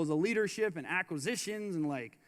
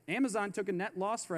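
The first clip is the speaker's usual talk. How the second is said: ordinary talk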